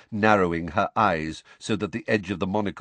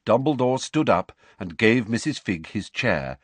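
ordinary delivery, nothing marked

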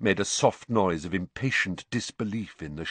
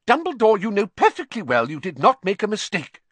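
high, loud scolding; angry; 12 semitones higher, 5 dB louder, faster than usual. A narrator's reading. McGonagall contradicting Dumbledore, angry. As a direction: tell him off angrily. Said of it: "said Professor McGonagall angrily".

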